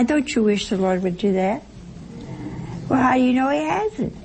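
usual speaking voice

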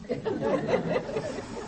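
a real high, breathy giggle; high laugh. Real, not acted. Preacher laughing at the invisible corner, real giggle. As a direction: laugh at your own joke.

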